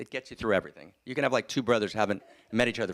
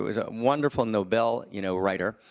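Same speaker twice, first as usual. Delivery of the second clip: ordinary talk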